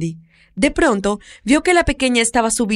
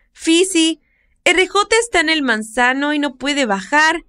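put-on high, eager child calling out; little girl voice; 6 semitones higher than usual. Little girl calls the older woman about the cat, high child voice. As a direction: play a worried little girl.

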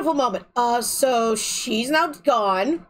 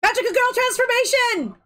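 anime transformation shout; 8 semitones higher than usual. Acted.

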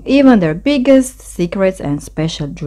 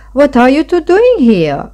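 high, raised surprised question; surprised cry; 8 semitones higher, 4 dB louder than usual. A narrator's reading. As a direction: ask as if startled to find them there. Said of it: "cried Vole in surprise".

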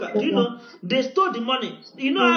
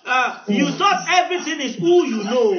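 raised, pressing exhortation; fervent preaching; 3 semitones higher than usual. Acted. Preacher challenging churchgoers who trust only fasting, fervently raised. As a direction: drive the point home to the crowd.

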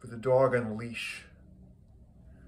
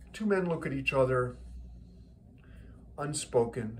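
ordinary delivery

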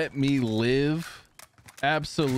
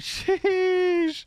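high drawn-out sheesh; 13 semitones higher than usual. Real, not acted. Reacting to a close call.

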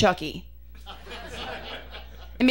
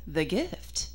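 slow, softer, deadpan "the gift is my magic"; completely serious; 6 semitones lower, 7 dB quieter, much slower than usual. Retold or imitated. Magician boyfriend answering her at a wedding, slow and deadpan. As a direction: say it softer and slower, dead earnest like a pompous magician. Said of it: "completely serious".

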